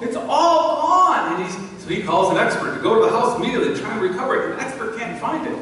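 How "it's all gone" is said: high, loud, slow mock wail of despair. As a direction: drag it out like a sobbing wife on the phone. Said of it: "she's screaming and crying on the phone".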